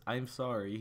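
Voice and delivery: usual speaking voice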